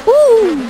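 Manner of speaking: high, loud woo; delighted whoop. Real, not acted. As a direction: whoop in triumph. Puzzle works in game.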